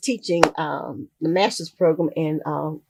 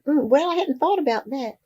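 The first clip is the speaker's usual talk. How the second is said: higher-pitched mock pondering; hesitant musing; 7 semitones higher than usual. Author recalling her answer to her teacher, hesitant musing. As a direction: replay a caught-off-guard, noncommittal reply. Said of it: "And I did like that".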